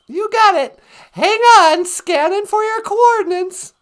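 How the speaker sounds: chirpy robot sidekick offering a ride home; high, squeaky sidekick voice. Pretend. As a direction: squeak it like a tiny robot pal. Voicing a spaceship companion.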